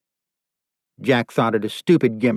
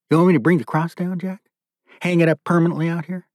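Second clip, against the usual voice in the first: fast, higher-pitched threat as a question; threatening; 6 semitones higher, faster than usual. Acted. Captor threatening to string up his prisoner, taunting.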